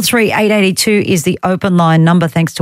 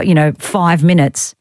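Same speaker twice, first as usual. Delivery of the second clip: usual speaking voice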